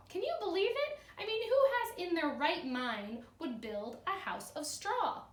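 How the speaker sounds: expressive read-aloud storytelling; cheerful classroom story reading; story narration. A narrator's reading. Teacher reads the story aloud.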